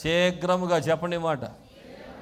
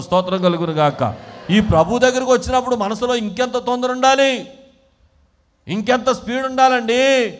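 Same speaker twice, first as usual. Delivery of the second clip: loud, high, insistent preaching; preaching at full force; 3 semitones higher, 10 dB louder than usual. Real, not acted. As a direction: urge the congregation on forcefully.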